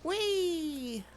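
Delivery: excited whee. Real, not acted. Riding the airship, joyful cry.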